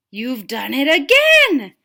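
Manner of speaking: high, loud and slow exclamation; slow, loud, high-pitched character cheer; excited character voice. Acted. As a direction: cry it out in a put-on voice, amazed.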